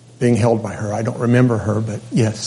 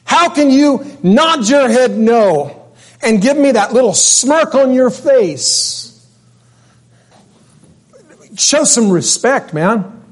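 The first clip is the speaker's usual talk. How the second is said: indignant rebuke; 10 semitones higher, slower than usual. Retold or imitated. Preacher rebuking a smirking man.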